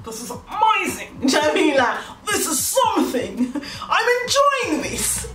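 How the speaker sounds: high, drawn-out gushing with laughter; mock rapture. Inner voice during a first kiss, hammed-up thrill. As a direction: squeal it slowly, hammed-up delight.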